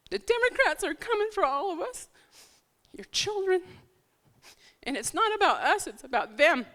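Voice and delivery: pleading through tears, pitch climbing; emotional, near tears. Real, not acted. Candidate to church crowd, fighting back tears. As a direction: voice breaking high with emotion. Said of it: "I'm sorry to get emotional".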